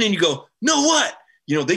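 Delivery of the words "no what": mock eager surprise. Acted eager reply.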